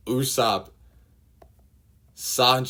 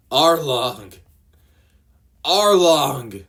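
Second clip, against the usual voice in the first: much louder and higher, screaming the name; anguished scream; 7 semitones higher, 11 dB louder, much slower than usual. Acted. Nami stabbing her tattoo, anguished scream. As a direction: scream the name in rage and despair.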